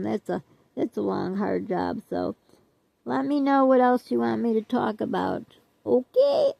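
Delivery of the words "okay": "Okay" squeaked very high; high squeaky okay. Acted. Signing off to viewers with a high squeaky "Okay". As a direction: chirp "Okay" up high and bright.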